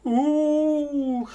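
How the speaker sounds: drawn-out high ghost moan; ghostly ooh. Retold or imitated. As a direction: moan like a marsh spirit. Eerie marsh noises in tale.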